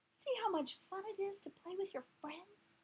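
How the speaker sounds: small hamster voice. Acted. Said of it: "I asked".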